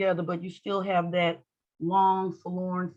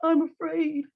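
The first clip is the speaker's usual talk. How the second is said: high-pitched pretend fear; afraid; 8 semitones higher than usual. Shown as a demonstration. Teacher jokingly demonstrating fear to make students laugh, mock afraid. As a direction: squeak it out in mock fright. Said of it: "That's the face of fear".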